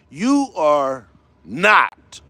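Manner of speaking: ordinary talk, nothing marked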